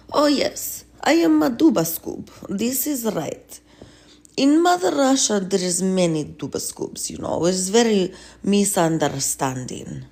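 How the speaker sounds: a hint of a boastful Russian leader; Putin with a Russian accent. Putin proudly agrees with the healer, mock Russian accent. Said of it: "So, Putin".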